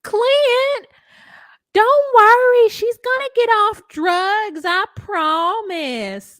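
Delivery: high, loud, drawn-out mock mother wail; hammed-up, high-pitched pleading reassurance, words stretched out; Alice. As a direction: call it out high and slow, overdoing the promise. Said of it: "She's not like Alice from".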